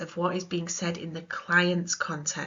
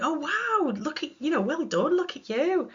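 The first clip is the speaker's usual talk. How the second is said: high, gushing well done; mock over-the-top praise; gushing congratulations; 9 semitones higher than usual. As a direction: overdo the delight. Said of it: "Normally we might be like".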